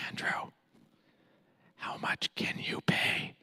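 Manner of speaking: hushed whisper in my ear. Retold or imitated. Friend whispers the price into my ear. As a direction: lean in and whisper the question. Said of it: "whispers in my ear".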